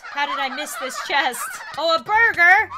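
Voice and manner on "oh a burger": loud, high exclamation; delighted surprise. Real, not acted. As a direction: exclaim over a surprise treat. Finds a burger in chest.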